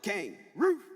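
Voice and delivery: high, loud bark back at the dog; playful mock bark. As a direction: yell it like a bark.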